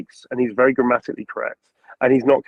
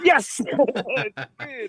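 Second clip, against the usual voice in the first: much higher, jubilant yelp of yes; excited yes; 12 semitones higher, much slower than usual. Retold or imitated. Actor retells answering the director's question, high jubilant yes. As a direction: burst out with delight. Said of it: "I was like".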